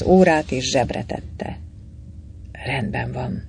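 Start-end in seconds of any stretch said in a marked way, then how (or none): 2.7-3.4 barely voiced, much softer; a hushed, secretive agreement; whispered. A narrator's reading. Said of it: "súgta".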